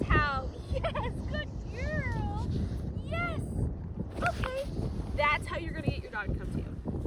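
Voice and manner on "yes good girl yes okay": high, bright doggy praise; happy praise. Real, not acted. Trainer praising her dog, high and bright. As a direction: gush over her.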